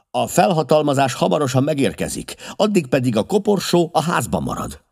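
high, forceful retort; firm defiance. A narrator's reading. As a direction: lay down the order, unyielding. Holmes defies Peters over the coffin.

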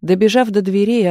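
ordinary delivery, nothing marked

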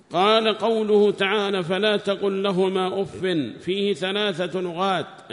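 high, loud, slow recitation of a text; declaimed scholarly reading; reading aloud. Acted. Reads the book aloud.

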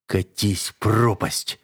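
slow, strained growled retort; snarled answer, dragged out slowly; angry growl. A narrator's reading. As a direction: growl it out through pain, drawn out. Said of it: "рыкнул я в ответ".

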